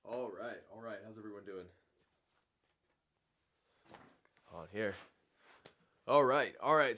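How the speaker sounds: talking through a sick, rough throat. Real, not acted. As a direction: voice worn by a cold. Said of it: "with his sick voice coming on".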